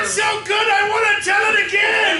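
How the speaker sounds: loud boisterous pirate captain; pirate captain shout. Acted. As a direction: bellow like a jolly captain.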